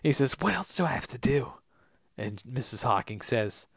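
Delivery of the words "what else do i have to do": breathy whispered question. Retold or imitated. Daniel pleading with his mother in a whisper. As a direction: whisper it under your breath.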